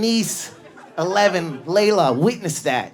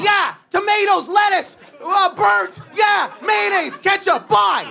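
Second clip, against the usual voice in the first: high yelled list; mock shouting; 3 dB louder, slower than usual. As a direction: shout your order over the bus. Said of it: "a nut bar that just goes".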